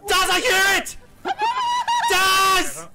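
panicked high yelling for help; being chased. Real, not acted. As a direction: yell for your friend in terror. Chased by monster, calling friend.

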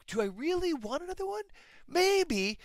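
high, musing mock self-talk; musing parent. Parent musing about a third child, high and playful. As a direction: pitch it way up, mulling it over. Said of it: "You're like".

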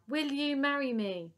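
loud, high, irate demand; shouted proposal. A narrator's reading. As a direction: shout the question at the beloved. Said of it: "There's no need to shout".

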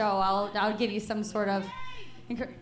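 normal talking voice

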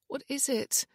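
high-pitched fearful question. A narrator's reading. Wife asking Ian what is wrong, fearful. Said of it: "fear in her eyes".

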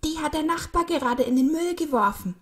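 put-on high, loud outraged boy; calling from afar. A narrator's reading. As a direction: shout the news across to mum. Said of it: "rief er schon von weitem".

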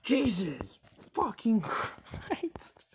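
slow high loud exasperated cry; drawn-out exclaim. Real, not acted. Player escaping the monster, slow high exclaim. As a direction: stretch it out in shock. Relief after chase.

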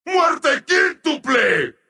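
high, drawn-out announcer shout; excited announcer cry. Acted. Announcer hailing a quintuple kill, excitedly. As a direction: stretch it out high and rousing. Bigger take of announcer call.

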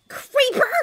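very high, loud insult scream. A narrator's reading. Edith from admin screaming an insult at him, shrill shout. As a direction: shriek the insult. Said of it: "screamed Edith".

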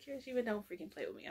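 a hint of a mom scolding a runaway kid; stern mom voice. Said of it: "I'm a mom, okay?".

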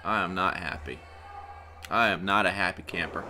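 low, soft, sulky displeasure; upset. Real, not acted. Commentator unhappy with the new ref, subdued upset. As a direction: state it low and restrained. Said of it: "I'm upset".

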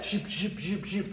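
soft shoop shoop beat; beatboxing. Pretend. Teacher bringing the beat back, soft shoop. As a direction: make a quiet little beat. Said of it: "bring that beat back".

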